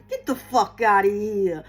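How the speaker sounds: low-pitched New Jersey Italian accent. Shown as a demonstration. Reader giving viewers their mantra, New Jersey Italian accent. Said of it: "Say it in a New Jersey Italian accent".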